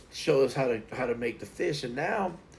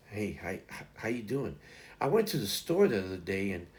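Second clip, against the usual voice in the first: imitating her calm; 4 semitones lower, 7 dB quieter than usual. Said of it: "She's just like".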